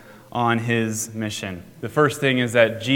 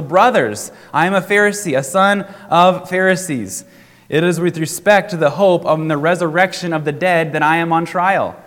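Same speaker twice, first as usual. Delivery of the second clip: higher and louder, calling out to the council; crying out; 5 semitones higher, 6 dB louder than usual. A narrator's reading. Paul crying out to the divided council, raised and urgent. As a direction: plead your case across a crowded room. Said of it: "he cried out in the council".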